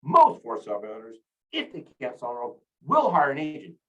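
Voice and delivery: "Most", "if" and "will" each hit hard at the start of a phrase; overemphasized key words. Shown as a demonstration. Trainer drilling accents into his brain, overemphasizing "Most", "if", "will". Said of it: "I'm overemphasizing those three words".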